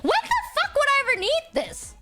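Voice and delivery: high, indignant disbelief; outraged how-dare-you squeal; incredulous. Real, not acted. Mock outrage at toggle.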